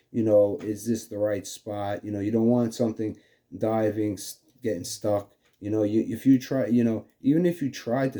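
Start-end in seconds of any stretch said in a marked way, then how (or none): none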